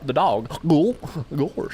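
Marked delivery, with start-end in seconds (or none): 0.7-1.1 voiced cartoon gulp. Shown as a demonstration. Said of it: "a real cartoon gulp from Goofy".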